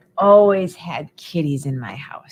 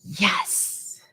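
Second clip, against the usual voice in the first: whispered yes; 7 dB quieter than usual. Shown as a demonstration. Whispered answer.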